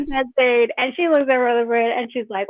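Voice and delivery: ordinary talk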